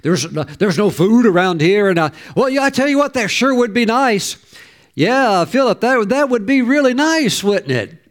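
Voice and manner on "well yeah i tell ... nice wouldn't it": a much higher, sing-song mock hint; hammed-up coy suggestion, pitched up high. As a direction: drop a hint sweetly, hoping they'll take it. Preacher voices someone dropping hints.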